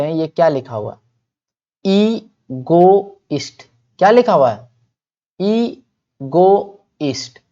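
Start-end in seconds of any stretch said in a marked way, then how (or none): 1.8-3.6 ईगोइस्ट drawn out in three beats, ee - go - ist; syllable-by-syllable. Shown as a demonstration. Teacher has class read the word, ईगोइस्ट slowly syllable by syllable. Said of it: "repeat करो खुद से". 5.4-7.4 ईगोइस्ट drawn out in three beats, ee - go - ist; syllable-by-syllable. Shown as a demonstration. Teacher has class read the word, ईगोइस्ट slowly syllable by syllable. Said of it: "repeat करो खुद से".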